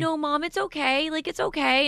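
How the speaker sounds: high-pitched imitation of a small son; reassuring little boy. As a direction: imitate a child reassuring Mom. Mother voices her reassuring little son.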